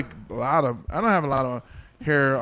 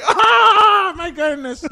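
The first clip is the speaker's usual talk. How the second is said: high loud "Ah" dropping into a squeal; comic shocked outburst; mock startled scream; 7 dB louder, much slower than usual. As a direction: shriek as if just walked in on, hammed up. Acting out being walked in on.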